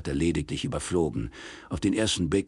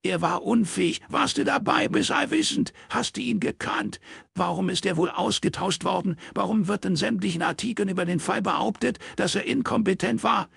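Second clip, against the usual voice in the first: high, mocking old man's scorn; sneering father; 14 semitones higher than usual. Father mocking his daughter, high sarcastic scorn. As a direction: scoff at Miss Know-it-all.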